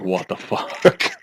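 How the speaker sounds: slow, soft, stunned what the fuck; stunned disbelief. Retold or imitated. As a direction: drag it out quietly in disbelief. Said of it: "and I was just like".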